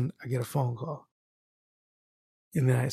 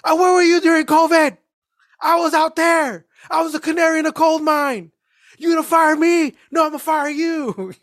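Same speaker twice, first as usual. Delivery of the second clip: imagined rant; 9 semitones higher than usual. Retold or imitated. Mail carrier rehearsing a rant at postmaster Jim, shouting high. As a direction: tell off the postmaster in your head. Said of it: "I'm arguing with the postmaster".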